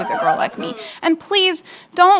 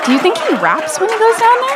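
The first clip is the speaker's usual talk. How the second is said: giddy; 10 semitones higher, 5 dB louder, faster than usual. Real, not acted. Excited punchline about a rapper.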